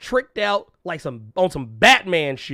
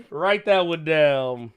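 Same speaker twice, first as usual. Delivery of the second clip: slow, spelled-out "write that one down"; slow emphatic; much slower than usual. Shown as a demonstration. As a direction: say it slowly like dictating. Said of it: "Write that down".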